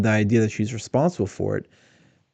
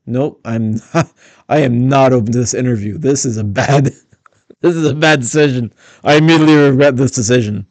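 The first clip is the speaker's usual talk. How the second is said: louder, laughing mock refusal; mock inner voice; 5 dB louder than usual. Commentator voices her body language, mock defiant and laughing. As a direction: voice her crossed arms, half laughing. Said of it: "to kinda say".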